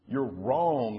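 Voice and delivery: mildly combative narrator's voice; arguing island. A narrator's reading. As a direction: push back in a storybook argument. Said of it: "argued the island".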